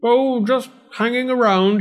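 high, loud, airy excuse; put-on high landlord voice; feigned nonchalance. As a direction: play innocent when caught, hammed up. Caught outside window, feigns nonchalance.

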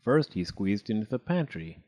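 plain reading voice

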